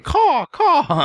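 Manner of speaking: high screechy eagle caw; shrill screeching bird woman. Retold or imitated. Mimicking eagle call at enclosure.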